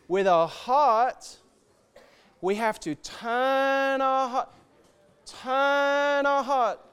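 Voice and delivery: slow and rising, ending in a full shout; building to a shout. Real, not acted. Preacher to the congregation, slow rising call ending in a shout. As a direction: build slowly then cry out to the Lord.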